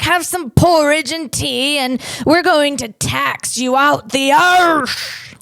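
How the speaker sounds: slow, hammed-up British accent; mock British accent. British threatening colonists with taxes, mock British accent. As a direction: draw it out like a cartoon Brit. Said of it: "I can't do a British accent".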